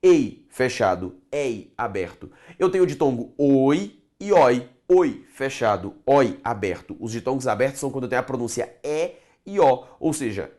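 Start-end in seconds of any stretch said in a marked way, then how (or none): 0.0-2.3 Ei with closed e, ei with open é, slowly; closed vs open vowel. Shown as a demonstration. Teacher to class, contrasting closed and open ei. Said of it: "fechado ... aberto". 4.9-7.0 Oi with closed o, oi with open ó, slowly; closed vs open vowel. Shown as a demonstration. Teacher to class, contrasting closed and open oi. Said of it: "fechado ... aberto".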